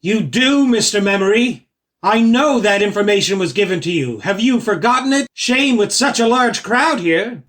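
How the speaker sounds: goading the performer loudly; loud pressing. Acted. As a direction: push him in front of everyone. Pressing performer loudly before crowd.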